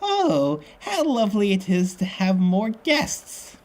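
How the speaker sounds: put-on high, slow, falsely sweet old lady; phony sweet voice. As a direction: lay on the fake sweetness, pitched right up. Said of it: "I gotta say it like that".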